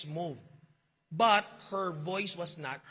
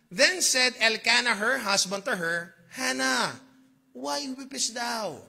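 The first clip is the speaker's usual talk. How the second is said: scripture reading; 4 semitones higher, 6 dB louder, slower than usual. Acted. Reads Bible passage aloud.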